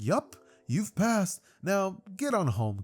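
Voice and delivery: lightly voiced nurse sending a kid home; breezy nurse reply.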